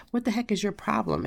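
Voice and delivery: usual speaking voice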